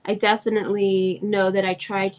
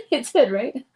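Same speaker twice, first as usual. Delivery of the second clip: normal talking voice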